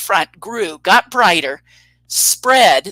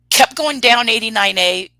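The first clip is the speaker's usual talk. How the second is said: ordinary talk, nothing marked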